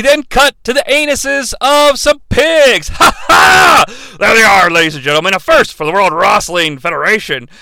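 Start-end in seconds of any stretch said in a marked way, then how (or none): 0.0-3.8 high, loud delighted yell; excited shout. Real, not acted. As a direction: yell it out, laughing. Excited commentary outburst.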